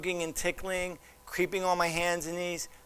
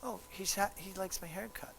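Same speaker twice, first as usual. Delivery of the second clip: pleased listener's thought; 3 semitones higher, 9 dB quieter than usual. Acted. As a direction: quietly voice the flattered reaction. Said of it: "What do you think?".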